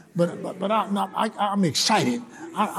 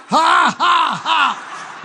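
loud, high preacher's "ha ha"; deliberate laugh; 10 semitones higher, 5 dB louder, much slower than usual. Shown as a demonstration. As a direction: belt out each ha on purpose. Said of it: "Let's laugh a little bit".

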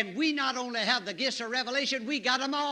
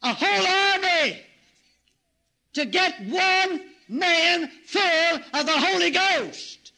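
higher, louder, slow pulpit cry; full-force preaching; 7 semitones higher, 8 dB louder, slower than usual. Real, not acted. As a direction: thunder it out to the congregation.

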